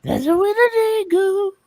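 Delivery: hammed-up posh British Queen voice; mock Queen. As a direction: greet your subjects like the Queen, mock-regal. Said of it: "The Queen has a very different accent".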